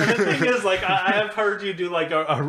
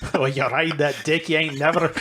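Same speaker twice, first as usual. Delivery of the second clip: put-on shaky-grasp Scottish accent; badly faked Scottish lilt; attempted Scottish. Comedian reading rap lyrics, badly faked Scottish. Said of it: "some Scottish stuff".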